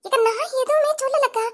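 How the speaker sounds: high, tearful plea of innocence; high whining denial to the angry neighbour; whiny pleading. Acted. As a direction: beg them to believe you, near tears.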